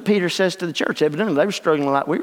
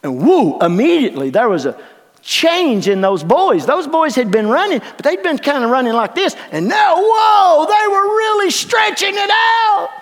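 loud, high preaching that builds; full-force sermon voice; fired-up preaching; 11 semitones higher, 6 dB louder than usual. Acted. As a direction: preach it with rising fire.

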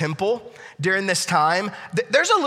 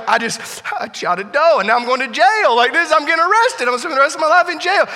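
panicked; 9 semitones higher, 6 dB louder than usual. Retold or imitated. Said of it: "he is panicked".

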